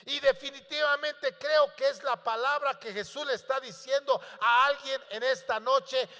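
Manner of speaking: ordinary talk, nothing marked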